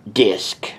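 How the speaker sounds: ordinary delivery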